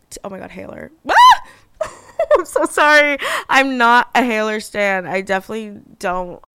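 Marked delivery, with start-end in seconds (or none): 1.1-1.2 short startled shriek; quick yelp at your own slip. Real, not acted. Startled outburst at her own slip. 1.8-5.1 loud, high, laughing protest; real laughing embarrassment, much louder; laughing apology. Real, not acted. Laughing apology after her slip.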